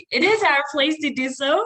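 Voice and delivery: joking, emphatic mock correction; sarcastic. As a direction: contradict your co-host with playful sarcasm. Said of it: "that's sarcasm, by the way".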